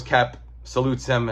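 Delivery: ordinary talk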